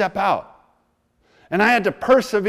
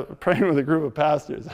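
normal talking voice

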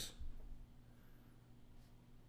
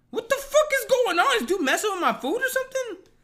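high, loud disbelieving yell with a laugh; incredulous outburst; 3 dB louder than usual. Retold or imitated. Telling viewers how he yelled at the stalled driver, laughing in disbelief.

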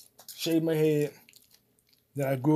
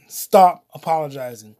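stern command; 3 semitones lower, 7 dB louder than usual. Retold or imitated. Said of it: "Fox said".

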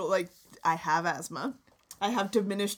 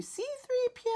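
squeaky. Acted. Naming a fussy robot.

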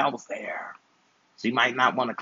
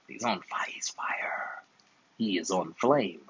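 hushed whisper; 4 semitones lower, 7 dB quieter, much slower than usual. Acted. Hyping the nephew's gameplay.

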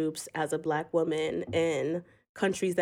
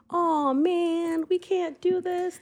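much higher whiny complainer; mock whiny complainer; 12 semitones higher, slower than usual. Mocking the group member who gives up, high whiny voice. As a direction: whine it like a quitter.

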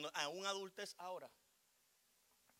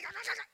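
high, rapid mock scolding cackle; mock scolding chatter; 6 dB louder than usual. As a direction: imitate nagging grown-ups as noise. Mimicking adults' scolding noise. (parloteo de regaño imitado)

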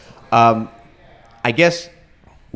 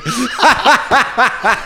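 high, helpless laugh at himself; burst of laughter; 13 semitones higher, 3 dB louder than usual. Real, not acted. Guest remembering crying after surgery, bursting into laughter. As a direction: crack up laughing at the memory.

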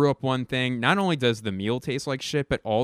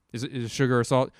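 faster, flustered double-check on salt; frantic repeat; faster than usual. Retold or imitated. Panicking at his partner over the sugar and salt, rushed and flustered.

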